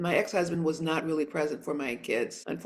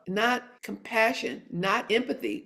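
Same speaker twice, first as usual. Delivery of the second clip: normal talking voice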